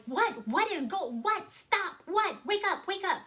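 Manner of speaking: high, loud panicked yelling; retold screaming. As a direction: scream at him to wake up. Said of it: "I'm screaming at him at this point".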